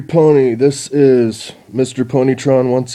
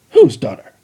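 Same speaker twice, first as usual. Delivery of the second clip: louder insistent demand; 8 dB louder than usual. Acted. Chrysalis pressing the spy, loud and insistent. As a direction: press for the answer. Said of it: "demanded Chrysalis".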